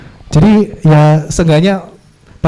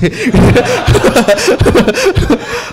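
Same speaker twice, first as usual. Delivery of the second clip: a long real laugh that falls away; amused laugh; 7 semitones higher than usual. Real, not acted. Vlogger laughing at the joke for viewers, amused laugh.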